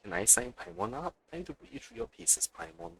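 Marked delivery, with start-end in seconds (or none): none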